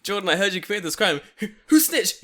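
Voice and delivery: high, loud, laughing officer voice; playing a cop, laughing through it; laughing mock cop. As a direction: ham up the arresting officer. Acted police arrest scene, laughing.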